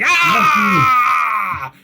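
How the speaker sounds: long, high, loud battle scream; sustained attacking yell; goblin scream. Acted. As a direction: scream it at them at full volume. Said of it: "screaming, basically, at you".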